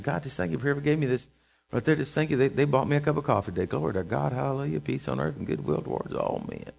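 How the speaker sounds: soft, low murmur of thanks; hushed acted praise to God; quiet thankful prayer. Acts out a private thankful prayer.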